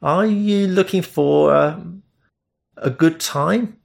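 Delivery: awkward, slow rehearsed offer; rehearsed yet uncertain. Acted. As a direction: say it like a line learned by heart but unsure. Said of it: "sounded rehearsed, yet uncertain".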